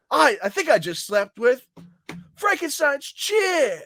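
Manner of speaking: high, slow and cracking with disbelief; stunned confession. Retold or imitated. O'Malley admitting he slept with Frankenstein's chick, high cracking voice. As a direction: let the voice climb and break on the last words.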